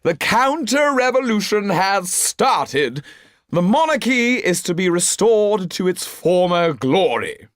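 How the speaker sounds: put-on high, slow drunken proclamation; a sozzled marquis announcing triumph; drunken bellowing. A narrator's reading. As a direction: bellow it while swaying drunk. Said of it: "swaying from side to side and bellowing".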